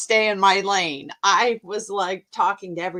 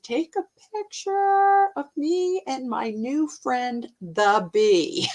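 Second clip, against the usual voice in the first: higher, slower, cheerfully unbothered; mock calm; 5 semitones higher, slower than usual. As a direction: act totally relaxed with a bee on you. Calm request despite bee.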